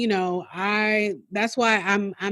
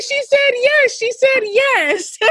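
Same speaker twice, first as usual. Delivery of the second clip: much higher, overjoyed and breaking; tearful joy; 4 dB louder, slower than usual. Retold or imitated. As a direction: cry it out with happy disbelief. Retold joyful crying reply.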